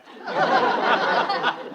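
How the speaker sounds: giddy laugh, way up high; breathy giddy laugh; high wild laugh. Acted. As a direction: cackle with reckless glee. Father laughs at being called mad.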